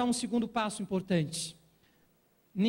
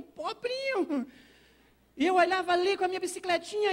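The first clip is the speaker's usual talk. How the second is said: mock pitiful; 8 semitones higher than usual. Preacher mocking his skinny younger self, high and whiny. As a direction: pity yourself with a long high whine.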